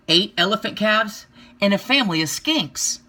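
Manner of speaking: usual speaking voice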